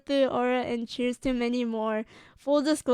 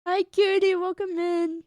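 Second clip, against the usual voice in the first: bright greeting; 6 semitones higher than usual. Real, not acted. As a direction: greet the viewer happily. Greeting a chatter.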